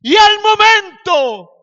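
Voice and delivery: high, loud, slow shout; full-force preaching. Real, not acted. Preacher announces Jesus's immediate rescue, full-force preaching.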